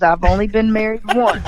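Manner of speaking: ordinary delivery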